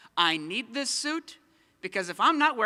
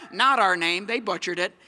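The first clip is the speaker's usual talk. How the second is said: usual speaking voice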